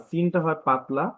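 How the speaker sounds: ordinary talk, nothing marked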